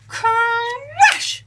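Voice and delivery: ordinary delivery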